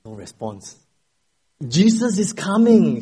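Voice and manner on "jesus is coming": raised, slower, higher call; louder proclamation. Acted. Pastor raising his voice to a silent congregation, louder. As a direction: proclaim it louder to wake the room. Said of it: "He raised his voice even louder".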